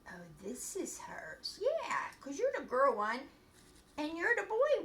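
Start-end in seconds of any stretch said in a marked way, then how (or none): 0.1-1.6 slow whispered aside; hushed self-talk, slow. Real, not acted. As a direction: whisper to yourself while fixing it. Quiet aside fixing puppet costume.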